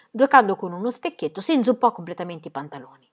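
ordinary delivery

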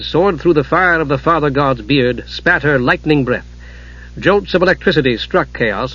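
usual speaking voice

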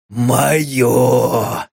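low, grinding demon voice; a scrape and a growl forming a word; demonic echo. A narrator's reading. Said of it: "словно эхом повторило".

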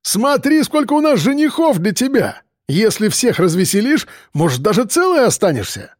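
jeering. A narrator's reading. Рыжий taunting the captive.

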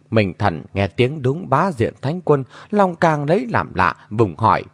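ordinary delivery, nothing marked

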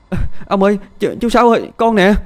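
put-on frightened little girl. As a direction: cry out like a scared small child. Little girl runs up calling. (bé gái hoảng sợ gọi ông)

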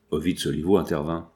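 usual speaking voice

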